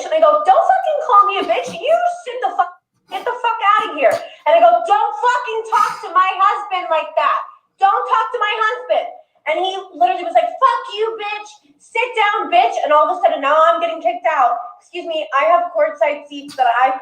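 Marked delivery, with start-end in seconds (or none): 0.4-4.1 high-pitched, indignant retort; angry comeback. Retold or imitated. Wife answering a player who insulted her, angry. 4.8-9.1 higher-pitched, emphatic telling-off; angry warning. Retold or imitated. Wife warning a player about her husband, angry. 10.5-12.8 high-pitched mimicked abuse; retold angry insults. Player cursing a courtside fan, angry.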